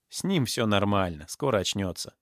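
plain reading voice

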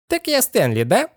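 very high squeaky query; uncanny talking head. A narrator's reading. Head learning the boy's name, squeaky query. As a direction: question it in a creepy high voice. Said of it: "переспросила голова".

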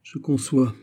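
gracious smile before the claws come out; feline graciousness. A narrator's reading. Madame de Saint-Rémy closing in on her opponent, graciously catty. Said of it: "avec le sourire gracieux du chat qui va griffer".

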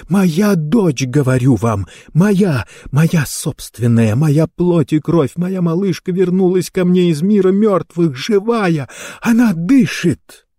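high, mounting ecstatic insistence; frantic mother. A narrator's reading. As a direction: build to a high, exultant cry. Frantic mother insists her child lives.

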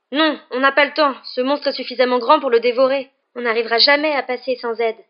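urgent loud protest. A narrator's reading. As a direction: insist desperately, nearly shouting. Urgent refusal amid danger.

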